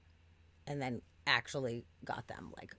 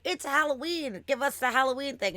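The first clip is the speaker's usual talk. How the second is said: higher, louder insisting; pushy demand; 10 semitones higher, 6 dB louder than usual. Retold or imitated. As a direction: demand it like a kid on Halloween. Demanding at the drive-thru.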